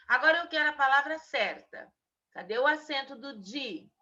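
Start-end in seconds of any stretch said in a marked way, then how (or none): none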